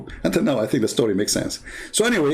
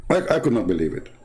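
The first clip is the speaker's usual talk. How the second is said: usual speaking voice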